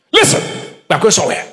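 low, understated authority. Retold or imitated. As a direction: declare it low, in short separate statements. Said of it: "I was talking with authority".

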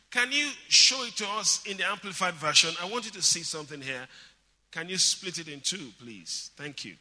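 normal talking voice

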